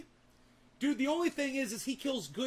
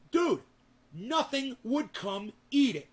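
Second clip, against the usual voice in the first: excited; 5 semitones higher, 5 dB louder, slower than usual. Real, not acted. Shock at the untouched rat.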